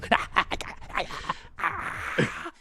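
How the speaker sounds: long, high evil cackle; creepy pumpkin cackle. Acted.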